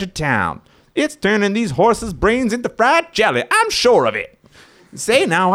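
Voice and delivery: put-on high, loud old-timer yokel; old prospector. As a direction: holler like a crusty old prospector. Sheriff character asks for help.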